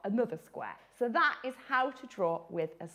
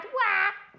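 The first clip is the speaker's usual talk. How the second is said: mock wail; 9 semitones higher than usual. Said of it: "how sad".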